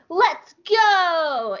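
high, bright little shout; eager cry. Retold or imitated. Chopper waving his arm, excited cry. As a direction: cheer like an eager droid. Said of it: "like he's saying".

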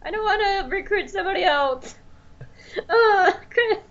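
high, whiny pretend plea; mock pleading. Host begging co-host to come back, mock-tearful whine. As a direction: beg a friend to return, hammed-up.

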